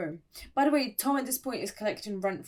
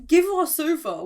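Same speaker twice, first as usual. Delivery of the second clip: loud, high indignant shout; 6 semitones higher, 10 dB louder than usual. Acted. Protesting the mum giving her away, loud shout. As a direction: yell it in disbelief.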